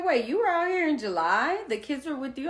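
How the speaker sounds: loud, high-pitched disbelief; caught-out challenge, pitched high and loud; incredulous confrontation. Retold or imitated. Retold confrontation with ex.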